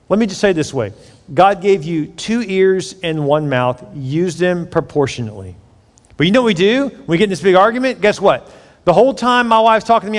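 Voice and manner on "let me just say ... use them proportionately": ordinary delivery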